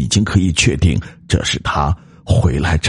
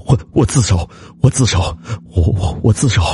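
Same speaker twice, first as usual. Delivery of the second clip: higher, trembling, tearful surrender; panicked stammer; 5 semitones higher, faster than usual. Acted. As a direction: stammer your agreement in terror. Terrified culprit gives in. (惊慌哭腔，结巴答应)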